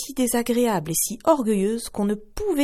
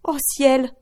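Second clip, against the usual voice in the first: higher, louder cry of dismay; mother's raised gasp of horror; horrified cry; 6 semitones higher, 4 dB louder than usual. Acted. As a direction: cry out at the sight. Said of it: "s'écria la mère".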